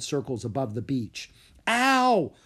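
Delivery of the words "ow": sharp, loud, higher cry of pain; yelled in pain. Acted. Carlos hears sounds in his head, loud pained yell. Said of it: "Carlos yelled".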